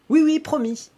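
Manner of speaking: put-on eager girl's promise. Girl promising the cow not to touch anything, high eager voice. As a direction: promise quickly like an eager child.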